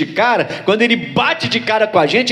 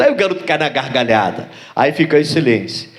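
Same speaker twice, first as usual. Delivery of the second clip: ordinary delivery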